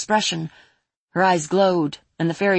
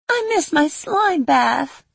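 wistful; 11 semitones higher, 3 dB louder, slower than usual. Acted. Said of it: "said and sighed".

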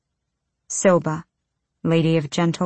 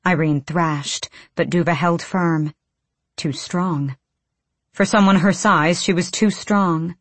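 ordinary delivery

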